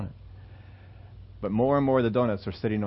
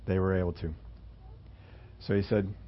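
ordinary talk, nothing marked

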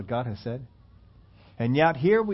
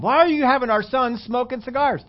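higher, lightly voiced yelling mom; mock yell; 9 semitones higher than usual. Mom scolding dad for the cigars, mock yell. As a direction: scold like an upset mother. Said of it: "'cause she's yelling at him".

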